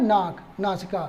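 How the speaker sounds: ordinary talk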